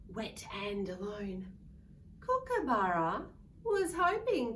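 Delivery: put-on loud, lively read-aloud for kids; storybook reading. A narrator's reading. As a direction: read it big for a room of children. Reading picture book to children.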